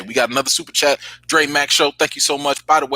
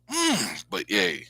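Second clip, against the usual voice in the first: usual speaking voice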